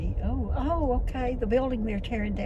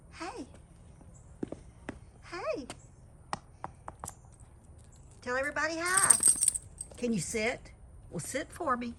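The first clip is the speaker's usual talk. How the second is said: talking to a dog; 6 semitones higher, 10 dB quieter than usual. Real, not acted. Coaxing dog to sit.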